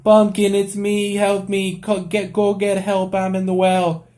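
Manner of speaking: put-on high, pleading boy's cry for help; desperate Timmy in the well. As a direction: beg the cat to fetch help from the well. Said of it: "he would be like".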